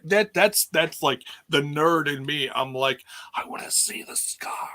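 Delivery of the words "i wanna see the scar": breathless high whisper of longing; hushed eager whisper; secret whisper. Retold or imitated. Whispered nerdy wish about scar.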